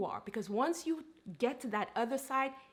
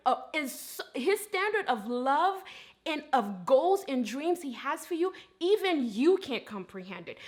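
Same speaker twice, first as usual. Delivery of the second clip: louder, higher sermon delivery, punched phrases; impassioned preaching; 4 semitones higher, 6 dB louder than usual. Real, not acted. Preacher telling listeners how God feels about them, impassioned preaching. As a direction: preach it with full conviction, driving each phrase home.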